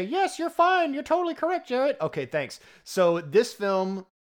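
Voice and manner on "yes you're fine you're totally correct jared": high mock reply for cohost; voicing cohost. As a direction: answer for him in a high voice. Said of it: "I'm gonna say".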